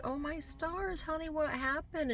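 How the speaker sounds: high, soft alarmed mom voice; worried mother. Retold or imitated. Mother finding her daughter with a bloody nose, high soft alarm. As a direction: gasp it out like a mother seeing her child bleed. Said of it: "And I'm like".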